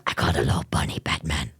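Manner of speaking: very low, fast rasping superhero voice; Batman. Acted. Streamer taunting Batman as Joker, deep growly voice. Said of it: "That's Batman's voice, not Joker's".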